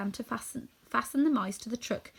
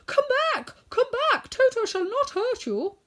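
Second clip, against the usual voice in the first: much higher and louder call after fleeing mice; 6 dB louder than usual. A narrator's reading. Woodman calling the scattering mice back, loud. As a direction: shout it to stop them running. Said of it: "he called back to the mice".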